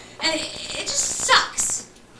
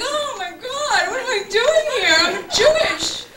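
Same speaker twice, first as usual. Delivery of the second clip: mock despair; 5 semitones higher than usual. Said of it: "You're just like".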